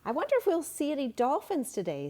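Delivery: put-on a curious girl, hopeful and lively; eager girl voice. A narrator's reading. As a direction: sound like a curious kid. Child character in story.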